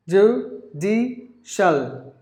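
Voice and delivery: "Judicial" very slowly as joo, dee, shul; three separate beats on "Judicial"; syllable by syllable. Shown as a demonstration. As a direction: sound out "Judicial" with pauses. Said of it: "Three syllables".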